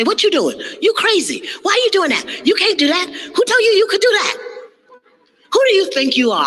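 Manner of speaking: high, incredulous mocking of the doubters; high mock scolding of the naysayers. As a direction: sneer like the crowd that doubts you. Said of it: "They're gonna say".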